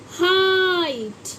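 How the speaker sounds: हाइट stretched slowly, long ai; drawn-out demo word. Shown as a demonstration. Teacher to pupils, slow drawn-out demo. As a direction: draw हाइट out so the ai is heard. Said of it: "H E I जी H T".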